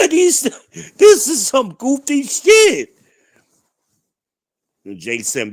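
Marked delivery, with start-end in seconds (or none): 0.1-3.0 exasperated. Real, not acted. Exasperated at goofy logic.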